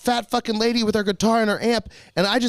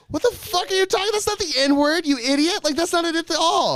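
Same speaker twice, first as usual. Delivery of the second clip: incredulous yell; 11 semitones higher than usual. Retold or imitated. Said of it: "And we're like".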